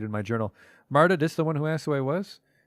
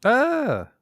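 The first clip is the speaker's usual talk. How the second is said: high, loud scream of pain; sharp shriek; 13 semitones higher, 7 dB louder than usual. Acted. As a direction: yell as the belly is cut.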